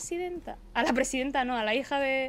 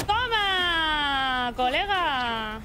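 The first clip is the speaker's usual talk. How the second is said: drawn-out cheer on a hit; celebración en pleno combate; much slower than usual. Real, not acted. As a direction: stretch both words out in triumph. Golpea al enemigo jugando. (exclamación de triunfo, alargando las palabras)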